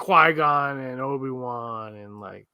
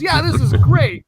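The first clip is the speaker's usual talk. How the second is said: very high, loud, drawn-out mock enthusiasm; enthusiastic dad imitation; 13 semitones higher, 9 dB louder, slower than usual. Son voicing dad raving about the book, enthusiastic. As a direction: gush slowly and loudly, imitating dad.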